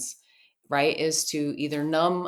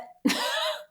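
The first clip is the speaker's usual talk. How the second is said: high laugh. Real, not acted. Laughs at own confusion.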